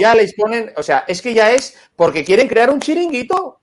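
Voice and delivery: high, loud, scornful rant; mocking indignation. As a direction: scoff at the scheme loudly. Mocking the politicians' scheme. (burla indignada y aguda)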